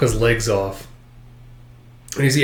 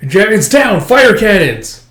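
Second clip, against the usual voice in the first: higher, loud and drawn out; shouted orders; 8 semitones higher, 6 dB louder than usual. Acted. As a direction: bellow the cannon command.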